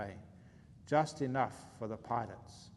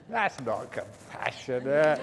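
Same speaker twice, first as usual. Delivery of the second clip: laughing; 4 dB louder, slower than usual. Real, not acted. Laughing reaction to punchline.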